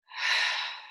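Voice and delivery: long audible breath through the nose; deep breath. Shown as a demonstration. Meditation leader models breathing for the group, deep breath. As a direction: demonstrate a slow, deep breath. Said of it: "take a deep breath in through the nose".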